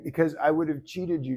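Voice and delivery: normal talking voice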